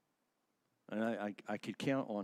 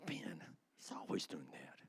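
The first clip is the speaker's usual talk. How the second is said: a whispered aside about a colleague; a hushed, teasing complaint; mock whisper; 7 semitones lower, 11 dB quieter than usual. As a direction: whisper it as if gossiping. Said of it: "He says".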